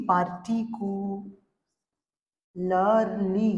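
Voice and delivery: "পার্টিকুলারলি" drawn out in five slow syllables; five slow beats on "পার্টিকুলারলি". Shown as a demonstration. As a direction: stretch "পার্টিকুলারলি" as পার, টি, কু, লার, লি. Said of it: "তাহলে কটা হলো syllable".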